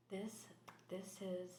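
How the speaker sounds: ordinary delivery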